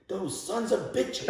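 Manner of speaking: high, slow, laughing mock outrage; furious curse. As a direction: spit out the curse in retold fury. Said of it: "this man doesn't cuss".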